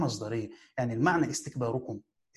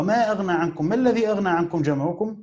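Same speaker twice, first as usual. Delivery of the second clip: ما الذي asked as a rhetorical question; 4 semitones higher, 3 dB louder than usual. Shown as a demonstration. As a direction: ask it so the answer is nothing. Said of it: "مضمن معنى النفي". (سؤال استنكاري)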